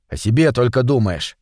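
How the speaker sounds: plain reading voice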